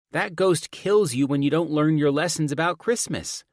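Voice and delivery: put-on high, loud child voice; insistent daughter. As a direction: set Dad straight like an exasperated kid. Voices the daughter correcting dad.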